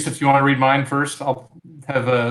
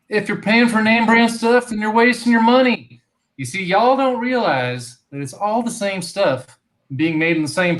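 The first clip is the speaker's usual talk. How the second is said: hammed-up country-boy ad read, much higher; hammed-up Southern pitchman voice; put-on rural Southern accent; 6 semitones higher, 3 dB louder than usual. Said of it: "instructions to read this with a certain type of accent, not Boston".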